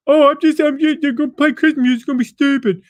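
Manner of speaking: high, loud whiny mimicry of a grumbling crowd; mocking whiny complainer. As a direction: sneer it out like a griping know-it-all.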